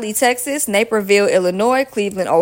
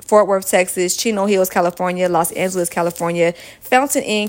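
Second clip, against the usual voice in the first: ordinary talk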